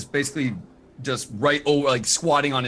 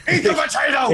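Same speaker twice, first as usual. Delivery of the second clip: yelling; 10 semitones higher, 4 dB louder than usual. Acted. [name] yelling again.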